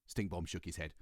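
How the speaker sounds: ordinary delivery, nothing marked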